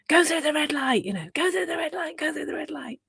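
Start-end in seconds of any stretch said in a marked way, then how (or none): 0.1-1.0 squawked order from the back seat; mock screaming. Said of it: "the Joker's screaming, screaming at you". 1.3-3.0 high, strained mock scream kept quiet; high, insistent nagging from the back seat. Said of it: "screaming at you".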